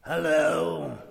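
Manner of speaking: gruff Scrooge growl. A narrator's reading. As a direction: growl it lightly like a miser putting on his old temper. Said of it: "growled Scrooge in his accustomed voice".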